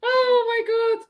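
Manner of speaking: very high, loud amused squeal; laughing squeal. Real, not acted. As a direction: shriek with amusement. Laughing at friend.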